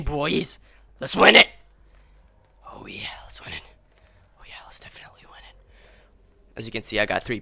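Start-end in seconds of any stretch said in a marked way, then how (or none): 1.0-1.5 loud, high rallying cry; rally the boys to win; punchy, excited call to victory; hyped-up. Acted. Hyping up, then whispers it. 2.7-3.6 hushed, low-key echo of a cheer; secretive whispered pep talk; whispered hype. Acted. Whispered repeat of the rallying cry. 4.4-5.6 very soft, quick whisper; hushed, rapid aside; whispered hype. Acted. Whispered repeat of the rallying cry.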